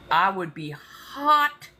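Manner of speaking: slow drawled Southern "hot". Real, not acted. Fuming at toll roads, slow Southern drawl. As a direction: draw out "hot" like a riled Southerner. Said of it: "the Southern starting to come out".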